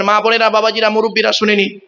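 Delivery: normal talking voice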